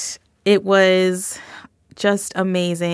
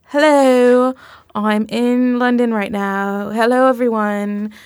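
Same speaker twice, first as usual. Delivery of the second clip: mock posh London lilt, higher and louder; horrible British accent; 4 semitones higher, 4 dB louder, slower than usual. Podcast host imitating a supermodel's live greeting in a bad British accent. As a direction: put on a bad English accent for the host's greeting. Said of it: "I'm doing a horrible accent right now".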